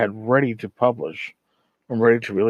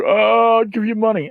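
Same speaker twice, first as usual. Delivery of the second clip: much higher and louder mock eager; hammed-up happy to give money; eager backer; 10 semitones higher, 5 dB louder than usual. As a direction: gush like a naive backer throwing cash. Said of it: "everyone's like".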